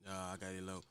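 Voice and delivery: clerk. Retold or imitated. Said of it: "With an attitude".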